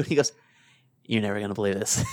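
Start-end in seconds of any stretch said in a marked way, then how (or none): 1.0-2.0 hushed. Retold or imitated. Buddy's hushed phone voice.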